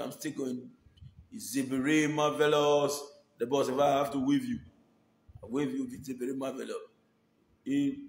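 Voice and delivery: ordinary delivery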